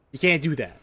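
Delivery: ordinary talk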